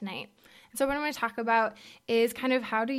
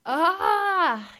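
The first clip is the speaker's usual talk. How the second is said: higher, louder pained "ah"; strained cry; 7 semitones higher, 7 dB louder than usual. Retold or imitated. Telling the audience about her hard year, pained cry. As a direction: let out a short cry of overwhelm.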